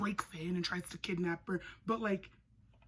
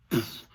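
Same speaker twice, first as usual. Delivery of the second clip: low punch sound effect; 9 semitones lower, 7 dB louder than usual. Retold or imitated.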